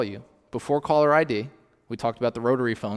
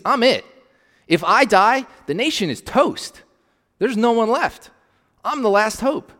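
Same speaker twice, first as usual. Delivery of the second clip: desperate Elijah; 8 semitones higher, 5 dB louder than usual. Acted. Elijah's despair voiced.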